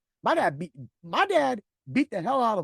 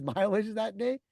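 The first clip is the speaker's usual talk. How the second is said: ordinary delivery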